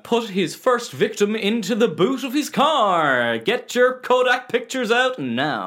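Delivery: slow, rising tour guide patter; hammed-up bus tour announcer. Mimics tour guide on murder bus.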